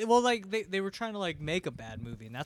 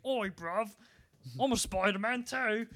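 higher-pitched mock British accent; mock British kid; 7 semitones higher, slower than usual. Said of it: "This is fucking British kid".

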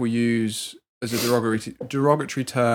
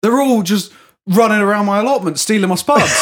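hammed-up, much higher and louder old man rant; mock ranting old bloke; 9 semitones higher, 9 dB louder than usual. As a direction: rant about the spuds like the old customer.

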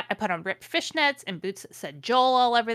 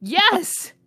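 higher, louder delighted cry; excited yes; 9 semitones higher, 10 dB louder than usual. Real, not acted. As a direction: cheer at the guess. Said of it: "excited agreement".

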